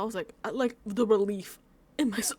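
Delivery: usual speaking voice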